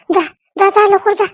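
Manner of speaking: high, anxious shooing; urgent pleading. Acted. Wife shooing aunt away before husband sees, anxious. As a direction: plead with her to get out fast. Begs visitor to leave quickly.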